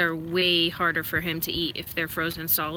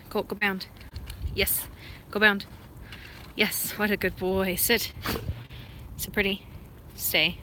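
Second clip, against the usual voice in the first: usual speaking voice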